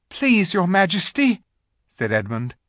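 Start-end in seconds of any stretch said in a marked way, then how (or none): 0.1-1.4 put-on high, loud pleading boy. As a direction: beg the queen. Edmund pleads with witch.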